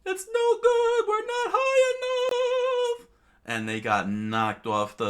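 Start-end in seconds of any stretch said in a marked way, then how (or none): 0.0-3.1 very high, loud falsetto; squeaky cartoon cry. Kid voicing a panicked character in a squeaky falsetto.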